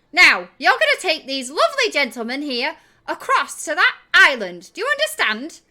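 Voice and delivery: high, very loud commanding voice; stern, high-pitched shouting; bossy shouted order. Acted. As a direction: bellow instructions to a scolded underling. Shrilt orders Gem loudly.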